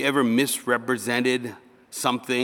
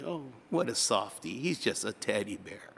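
softer, offhand remark; mock cooing aside; 8 dB quieter than usual. People looking at the preacher, soft teasing. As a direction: say it softly, as an onlooker.

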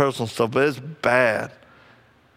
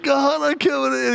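retold sobbing; 12 semitones higher than usual. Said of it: "he just starts sobbing".

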